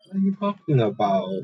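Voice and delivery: ordinary talk, nothing marked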